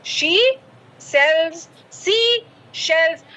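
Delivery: very slow, word by word; deliberate, spaced-out tongue twister; slow teaching demo. Shown as a demonstration. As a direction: say each word separately and slowly. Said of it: "अब मैं इसका हिंदी का अनुवाद".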